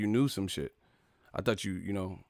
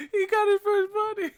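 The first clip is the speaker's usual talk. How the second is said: very high excited squeal; squealing with glee. Real, not acted. Streamer celebrates the new mod's first ban, high squeal. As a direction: shriek it with delight.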